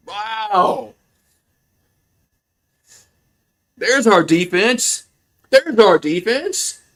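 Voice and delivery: high-pitched, drawn-out celebration; slow, thrilled cheer for the defense; elated. Real, not acted. Celebrating his team's interception.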